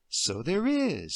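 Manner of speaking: slow, gentle humouring agreement; slow indulgent. Acted. Deirdre gently humouring Emily at the brush. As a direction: humour the child slowly.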